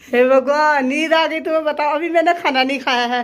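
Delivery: loud, high exclaiming in disbelief; loud dismay. Real, not acted. Finding sister-in-law asleep after eating, loud dismay. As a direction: cry out in loud mock outrage. Teasing outrage over sleeping.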